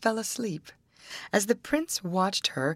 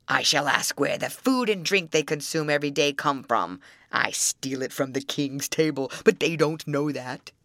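troll voice; 4 semitones lower than usual. Acted. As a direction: growl it out like an old troll.

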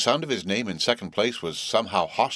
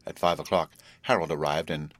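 plain reading voice

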